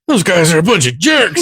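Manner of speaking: high-pitched put-on cartoon grumble; Anger. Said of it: "the anger guy was like".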